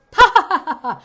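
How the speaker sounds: loud, high fake ha; mock an on-cue laugh, overdone; forced laugh on cue. Shown as a demonstration. Every actor laughing on cue, a loud fake laugh. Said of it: "Laugh here".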